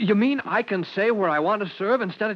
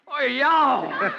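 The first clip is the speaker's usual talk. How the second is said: overjoyed whoop; 6 semitones higher than usual. Acted. Son learns he came top.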